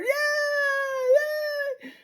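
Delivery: put-on a child cheering on a ride; daughter's hollering. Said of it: "she's hooting and hollering".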